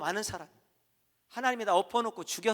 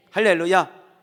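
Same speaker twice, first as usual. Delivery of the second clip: hallelujah shout; 14 dB louder, slower than usual. Acted.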